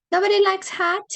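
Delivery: "Nobody likes hot?" thrown out in real surprise; surprised question. Real, not acted.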